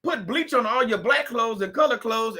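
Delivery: ordinary delivery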